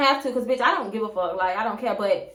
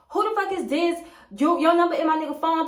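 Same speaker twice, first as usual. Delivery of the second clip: higher-pitched retold angry confrontation; cussing out; 4 semitones higher than usual. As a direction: mildly imitate a wife confronting her rival. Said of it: "went from cussing me out".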